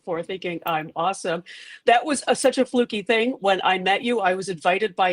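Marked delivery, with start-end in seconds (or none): none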